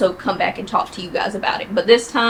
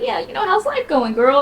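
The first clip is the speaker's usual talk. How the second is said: a high, breezy girl-talk impression; a coworker chirping the small talk high; high mock coworker chatter; 10 semitones higher than usual. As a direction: imitate her in a high sing-song voice.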